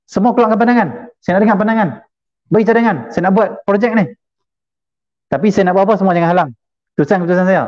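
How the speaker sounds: high-pitched announcing voice; imitating a boss addressing a meeting; bossy chairman. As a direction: issue each demand as a separate proclamation.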